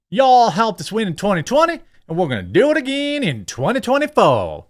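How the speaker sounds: high, mock-jubilant Southern accent; Kamala Harris. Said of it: "as she jubilantly told the crowd".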